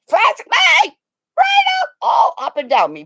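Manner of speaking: high, loud excited squeal; eager demand. Retold or imitated. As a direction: beg the officer at full volume. Acted eager demand to officer.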